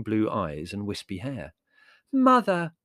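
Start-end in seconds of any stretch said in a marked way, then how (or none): 2.1-2.7 high, loud theatrical cry; dramatic. A narrator's reading. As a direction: hail her with great drama. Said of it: "said Margot dramatically".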